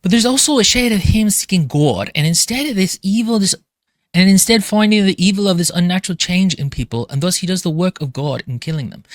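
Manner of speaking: put-on drifting into a loose Austrian accent; Austrian-ish accent. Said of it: "it turned a little Austrian there".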